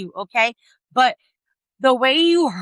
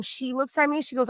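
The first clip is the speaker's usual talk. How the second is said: ordinary talk, nothing marked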